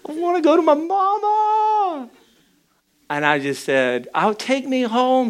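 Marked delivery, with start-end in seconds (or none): 0.1-2.1 very high mock-tearful wail; wailing yell. Wife on the floor yelling for mama, hammed-up wail. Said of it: "she yells out". 4.1-5.3 high-pitched mock plea; mimicked plea. Husband echoing crying wife's plea, mock whine.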